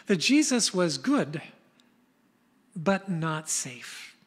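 drawn out, pausing before but not safe; slow emphasis. Shown as a demonstration. Preacher echoing Lewis's line about Jesus, slow and emphatic.

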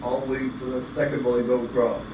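usual speaking voice

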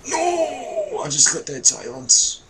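very high loud cry of dismay; anguished no. Real, not acted. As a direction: yell out in dismay. Outburst at game death.

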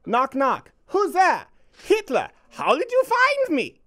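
high, put-on girl's voice for the joke; hammed-up high teenage girl voice; Anne Frank voice. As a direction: pitch way up to play young Anne Frank. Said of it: "This is written from Anne Frank's point of view".

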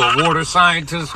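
ordinary talk, nothing marked

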